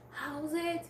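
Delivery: "How's it?" tossed off high as a quoted greeting; quoting a slang greeting. Shown as a demonstration. Student quotes the South African greeting, light and high. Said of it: "So they are".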